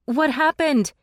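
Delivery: high, loud alarmed question; shouted question in the dark. A narrator's reading. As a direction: call out in alarm. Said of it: "Imogen shouted".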